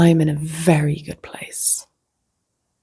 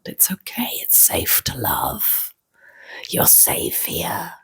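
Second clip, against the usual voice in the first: slow, soft whisper, lower; soothing whisper; 5 semitones lower, 8 dB quieter, slower than usual. Shown as a demonstration. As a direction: gently reassure someone scared in a hush. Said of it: "I guess that's the impression".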